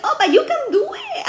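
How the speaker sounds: very high, swooping mock coaxing; coaxing encouragement. Master urging the student through pain, coaxing. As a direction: imitate a coach cheerily urging someone on.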